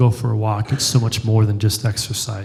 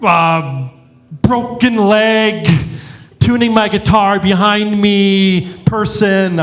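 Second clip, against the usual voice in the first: frantic pastor; 5 semitones higher than usual. Retold or imitated. Rants as stressed pastor.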